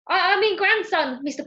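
high, loud stammered correction; flustered. A narrator's reading. Ben backs away from Mister Parker, flustered. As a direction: stumble into a hasty correction.

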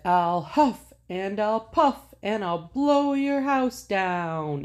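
high, huffing big bad wolf. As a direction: puff it out like a greedy wolf. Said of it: "The wolf said".

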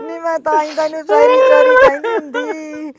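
high, loud, tearful mock lament; hammed-up wailing scold. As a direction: wail the blame at her sister. Wailing scolding to her sister. (ਉੱਚੀ ਨਾਟਕੀ ਰੋਂਦੀ ਝਿੜਕ)

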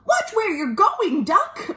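high-pitched flustered hen voice; squawky, high little warning; alarmed chicken. Acted. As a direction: warn in a high storybook chicken voice. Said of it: "what she thought was".

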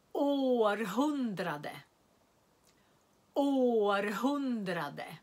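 Århundrade slowly, long å; careful repeated demo; slow model word. Shown as a demonstration. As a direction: stretch Århundrade for learners. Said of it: "jag säger alla orden igen".

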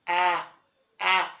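"آ" like an alef with a fatha; short-a letter sound. Shown as a demonstration. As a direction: say "آ" as an open "ah". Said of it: "هروح قاري ال A إزاي؟".

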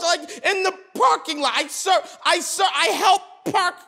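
high, insistent complaint to God; entitled pleading. Acted. Parking lot volunteer bargaining with God, indignant pleading. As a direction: protest as if owed a favor.

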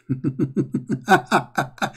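short staccato chuckle, falling away; a soft, quick run of ha-ha-ha; mocking laugh. Real, not acted. Host scoffing at the hadith being called weak, mocking laugh. Mocks the rebuttal he just played.

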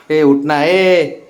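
ordinary talk

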